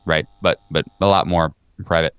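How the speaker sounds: ordinary talk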